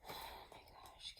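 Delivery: breathy whispered oh my gosh; a real whispered gasp of wonder; hushed gasp. Real, not acted. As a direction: whisper it in awe under your breath. Quiet gasp before drawing card.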